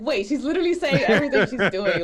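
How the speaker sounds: loud, high, laughing through the words; laughing realization. Retold or imitated. Recalling [name]'s sing-narration, laughing loudly. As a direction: burst out laughing as it clicks. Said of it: "I was like".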